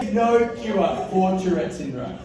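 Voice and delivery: ordinary talk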